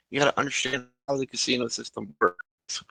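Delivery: soft unvoiced whisper; whispered explanation. Player quietly explains casinos to the doctor, whispering. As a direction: explain it quietly so others can't hear.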